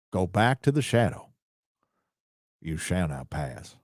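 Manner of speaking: mild Morgan Freeman voice reading Gandalf's speech. Retold or imitated. Reading a donor's joke, a lightly voiced Freeman Gandalf. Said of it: "I can do a much better Morgan Freeman impression".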